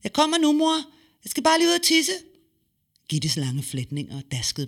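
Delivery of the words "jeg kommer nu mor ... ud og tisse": put-on high, louder girl's voice calling back; young girl's voice. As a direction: answer mum brightly, high and loud. Child answers mother. (høj pigestemme, der råber tilbage)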